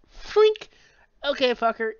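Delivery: sudden high, loud bark of Freak; mock furious shout grabbing the gun; retold yell. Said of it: "she pretty much goes".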